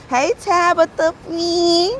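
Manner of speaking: high, slow, drawn-out hello; sing-song greeting. Real, not acted. Streamer greeting a regular viewer in a slow sing-song. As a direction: stretch the name out playfully.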